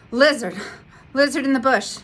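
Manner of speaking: loud urgent call. Acted. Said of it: "he called quickly".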